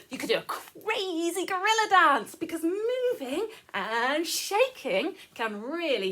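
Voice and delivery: loud, high and boisterous; wildly playful. Pretend. Teacher urging children to do a crazy gorilla dance, loud and excited. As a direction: burst out with silly gorilla-dance energy.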